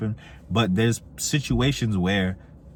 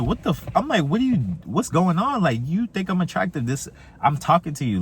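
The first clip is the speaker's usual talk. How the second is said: much higher, baffled "what's going on"; incredulous retelling; 9 semitones higher than usual. Retold or imitated. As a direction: replay the disbelief when she froze. Retold confusion at silent girl.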